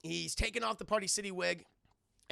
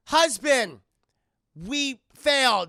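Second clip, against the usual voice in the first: hammed-up cry, very loud and higher; Sindel; 6 semitones higher, 14 dB louder, much slower than usual. Sindel telling her husband of defeat, in a loud melodramatic cry. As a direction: call out slowly, melodramatically, word by word.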